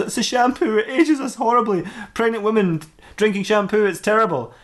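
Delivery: high-pitched comic lament; lamenting Samantha. Acted. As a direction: bemoan it in a high put-on female role. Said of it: "She goes".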